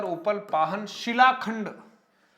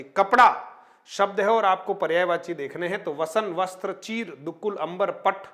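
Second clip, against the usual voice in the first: ordinary delivery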